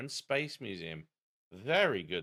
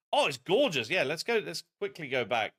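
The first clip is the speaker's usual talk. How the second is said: normal talking voice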